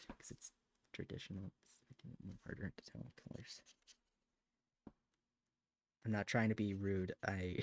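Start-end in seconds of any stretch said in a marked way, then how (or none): 0.1-3.6 much softer, unvoiced and fast; muttering to herself while drawing, whispered. Real, not acted. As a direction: barely breathe the words out. Drops voice to near-silence.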